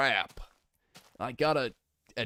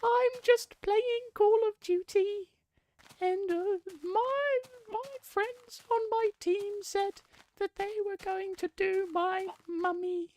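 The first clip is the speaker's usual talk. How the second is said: very high, slow falsetto; slow, childlike squeak of a voice; slower than usual. Acted. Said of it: "It's my impression of Tiny Tim".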